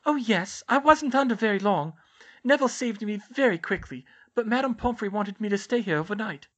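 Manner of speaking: Luna's character voice. Acted. Luna reassuring Hermione after the curse, high character voice. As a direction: keep her high and untroubled by it all.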